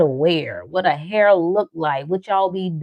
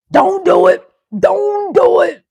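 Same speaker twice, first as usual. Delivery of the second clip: high, loud mocking warning voice; 12 semitones higher, 7 dB louder, slower than usual. Mocking the naysayers, high and loud warning. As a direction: wail it like a nagging naysayer.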